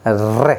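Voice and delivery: रे with the tongue rolled fast in a clear trill; teacher rolling रे for the class to repeat; trilled re demo. Shown as a demonstration. Teacher asks students to repeat after him, rolled रे. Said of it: "करके देखिए अच्छे से".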